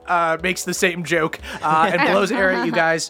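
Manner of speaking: ordinary talk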